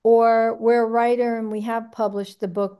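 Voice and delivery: ordinary talk, nothing marked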